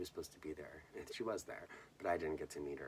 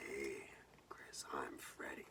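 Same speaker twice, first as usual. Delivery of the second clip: low, slow, whispered creepy greeting; Freddy Krueger voice; 4 semitones lower, 3 dB quieter, slower than usual. Retold or imitated. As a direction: purr it like a horror villain greeting a kid. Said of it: "did his voice".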